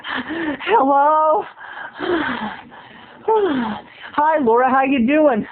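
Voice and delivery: high breathless mock answer; imitating Laura. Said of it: "And finally".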